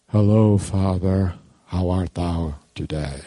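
low, slow, mock-solemn formal greeting; stiffly formal. Child greeting God too formally, low mock-solemn voice. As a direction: intone it deep and ponderous, hammed-up. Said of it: "It's not this informal".

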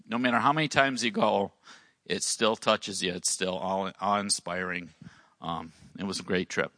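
usual speaking voice

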